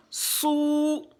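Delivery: "Sou" held long and high; drawn-out vowel. Shown as a demonstration. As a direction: stretch the oo of "Sou". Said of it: "Si on veut tirer le".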